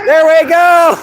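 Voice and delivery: loud, high cheer of delight; excited shout. Real, not acted. Vlogger cheering a stranger on in the park, excited shout. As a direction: whoop it out, thrilled.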